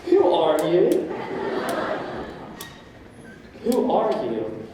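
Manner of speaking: slow, puzzled "Who are you"; bewildered wondering. Retold or imitated. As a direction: ask yourself, lost. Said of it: "you wonder to yourself".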